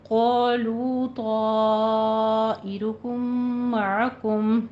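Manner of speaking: slow chanted tajweed recitation; Quranic recitation. Shown as a demonstration. Teacher recites the full verse for the class, chanted. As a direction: recite it melodically, drawing out the madd. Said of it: "teacher recites next verse".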